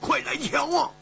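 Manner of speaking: high, alarmed call; startled cry. A narrator's reading. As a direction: call out in astonishment at a very high pitch. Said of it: "胡铁花一声惊呼说".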